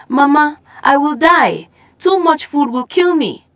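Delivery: high, desperate plea; panicked. Acted. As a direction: beg to be spared the food. Emeka fears the food.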